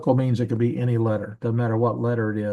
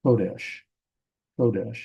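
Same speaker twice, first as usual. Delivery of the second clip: "kodesh" as KO-desh, accent on the KO; first-syllable stress. Shown as a demonstration. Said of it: "Notice the accent above the kof".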